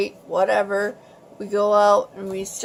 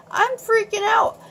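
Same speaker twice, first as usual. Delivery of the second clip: much higher, panicky outburst; storyteller reliving a scare in a high pitch; retold panic; 12 semitones higher than usual. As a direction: blurt it high, as if freaking out. Retold panic on the cliff road.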